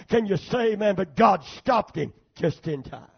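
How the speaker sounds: loud, driving sermon proclamation; shouted pulpit declaration; full-force preaching. Real, not acted. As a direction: thunder it out to the congregation.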